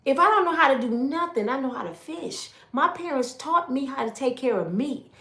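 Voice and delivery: high, loud and fast, bitter and cutting; angry rant. Retold or imitated. Wife tells husband who threw her out, high furious rant. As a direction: throw it back at him, fed up.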